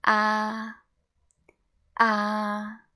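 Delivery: loud "Ah" held high and level; long, horizontal "Ah"; first tone. Shown as a demonstration. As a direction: sustain "Ah" on one steady pitch. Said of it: "read all together again from the beginning".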